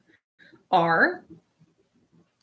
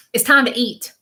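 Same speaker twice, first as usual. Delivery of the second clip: louder, higher call to the table; relayed call to eat; 4 semitones higher, 6 dB louder than usual. Retold or imitated. Reader relaying a woman's summons to eat, lightly called out. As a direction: call it out briskly, lightly acted. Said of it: "All she's saying".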